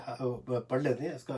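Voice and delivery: ordinary talk, nothing marked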